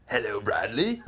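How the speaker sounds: imitated rock singer on the phone; put-on singer's greeting, rising at the end. Singer greeting guitarist by phone, playful impersonation. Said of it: "This is [name]".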